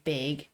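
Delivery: "big" said as BAYG; Wisconsin bag vowel. Shown as a demonstration. Showing how Wisconsin people say "big", ay vowel. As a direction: give "big" a long ay, as in vague. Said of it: "Wisconsin people say".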